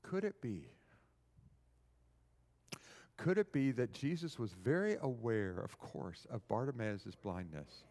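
hushed, low, reflective preaching; tender, solemn musing. Real, not acted. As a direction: bring it right down, low and gentle.

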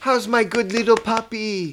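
high, cooing voice for a pet; puppy talk. Retold or imitated. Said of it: "Like he's a little dog".

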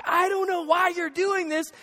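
hammed-up exasperated retort; mock exasperation. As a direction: act out a husband arguing back, baffled. Acted quarrel, husband answering back.

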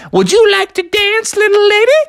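high, loud charming invitation; smooth suitor. Acted. Junior Bear asking a lady bear to dance, high and charming. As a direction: woo her with flair. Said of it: "asked a fine little lady bear".

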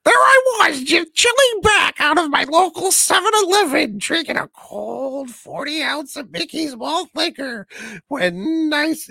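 very high, loud clown voice. Acted. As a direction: shout it in a creepy clown voice. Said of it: "his little pedophile clown voice".

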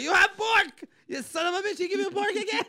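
high-pitched, loud scolding; mock-outraged mother shouting; angry mom. Mom catches kid with pork, angry shouting.

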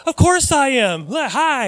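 high, slow, gushing reply; friendly greeting. Acted. Preacher to little kid, high friendly acknowledgement. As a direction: greet a child with exaggerated friendliness.

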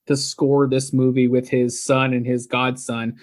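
ordinary delivery

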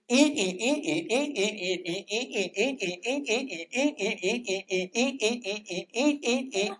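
soft, quiet coaxing repetition; lightly voiced friend urging eat; quiet, inviting chant of eat; gentle repeated urging. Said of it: "That sounded like this".

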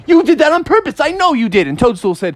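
put-on very high, insistent kid accusation; high accusing frog. Froggy insisting Toadstool pushed him, very high. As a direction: push it high and sure of yourself. Frogs quarreling on trampoline.

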